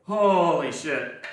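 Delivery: slow, drawn-out exhausted gasp; out of breath. Real, not acted. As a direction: drag it out, spent. Exhausted after the set.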